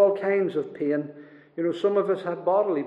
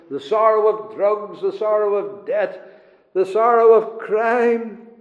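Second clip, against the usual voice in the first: loud sermon list, climbing in pitch; forceful preaching; 3 semitones higher, 6 dB louder than usual. Real, not acted. Preacher lists the sorrows of life, forceful preaching. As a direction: pile up each item with preacher's force.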